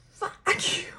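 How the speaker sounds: put-on high, whispered teenage fuck you; angry teen retort. Teen snapping back at parents, whispered high retort. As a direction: spit it back like a sulky teenager. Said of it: "You're like".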